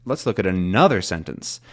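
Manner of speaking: "another" carries the main stress, "sentence" falls away. Shown as a demonstration. Teacher saying this word is important, stress on another. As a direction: punch the middle of "another". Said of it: "adding additional stress".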